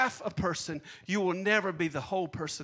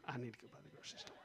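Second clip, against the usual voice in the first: quiet aside; 9 semitones lower, 21 dB quieter, much faster than usual. Retold or imitated. Aside after phone rings.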